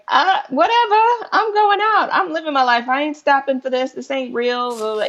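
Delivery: high-pitched mock defiance, dragging out the end; imitated careless scoffing, starting high and easing down; dismissive mimicry. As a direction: mimic people brushing it all off, pitched well up. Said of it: "I'm seeing people on social media".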